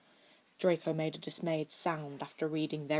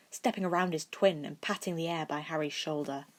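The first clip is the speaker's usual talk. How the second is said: ordinary delivery, nothing marked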